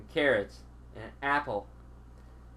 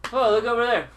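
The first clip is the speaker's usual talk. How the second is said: mock alarm; 5 semitones higher, 8 dB louder than usual. Fake distraction, pointing away.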